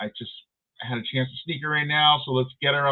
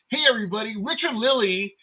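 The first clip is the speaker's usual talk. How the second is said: mock announcer intro; 9 semitones higher, 6 dB louder than usual. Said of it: "And I'm all".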